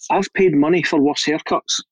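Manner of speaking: usual speaking voice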